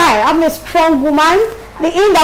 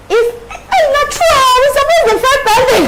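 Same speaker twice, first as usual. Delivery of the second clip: sudden realisation; 9 semitones higher than usual. Real, not acted. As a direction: cry out high as it dawns on you. Sudden outburst about forgotten plantain.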